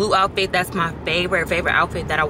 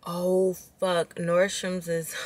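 usual speaking voice

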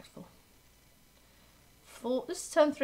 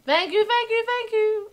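much higher, louder sing-song thanks; excited thanks; 12 semitones higher, 7 dB louder than usual. Real, not acted. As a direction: gush thanks to the follower. Repeated streamer thanks.

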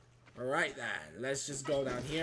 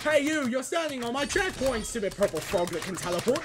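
high, loud angry call; loud yell; 7 semitones higher, 4 dB louder than usual. Pretend. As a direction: yell at it to move. Yelling at frog enemy.

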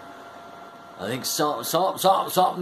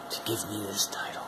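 softer whispered aside; 7 semitones lower, 11 dB quieter, much faster than usual. Acted. Fan thanking supporters, hushed whisper.

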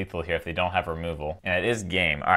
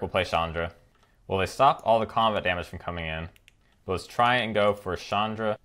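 usual speaking voice

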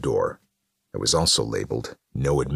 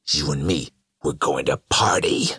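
hearty party call, pushed on the last words; rally them with mild rowdiness; Carnage crying out; 3 dB louder than usual. A narrator's reading. Carnage inviting killers to party, rowdy call. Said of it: "Carnage cried".

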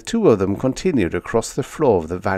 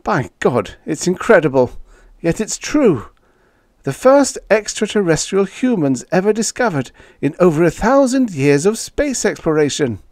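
high-pitched thrilled character voice; breaking the big news, pitched high with excitement; amazed, excited spaceman; 10 semitones higher, 5 dB louder than usual. Acted. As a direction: marvel aloud at a historic find. Spaceman marvels at the discovery.

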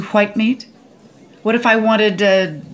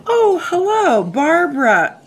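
high, hammed-up mock delight; pretend phone greeting; 9 semitones higher, 3 dB louder, slower than usual. Diner fakes a phone call from Barbara, hammed-up delight. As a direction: greet an imaginary caller in a swooping high voice. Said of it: "I'm gonna pretend to receive a phone call".

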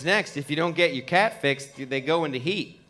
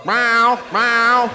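louder, higher cat call; loud distant tomcat; 6 semitones higher, 7 dB louder than usual. Retold or imitated. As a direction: yowl like a tomcat down the road. Neighbor cat calling.

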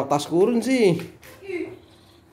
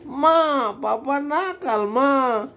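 higher, slow, mock whimpering child; imitating whiny child; 6 semitones higher, much slower than usual. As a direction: tease the kid by copying his whine. Father mimics crying kid again.

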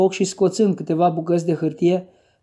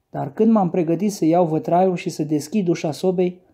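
plain reading voice

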